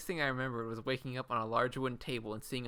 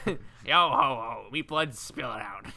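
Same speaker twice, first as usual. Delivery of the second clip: slow, hammed-up pirate chant; mock pirate sing-out; 6 dB louder than usual. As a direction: play a jolly pirate, drawn out. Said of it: "Oh, there's a pirate".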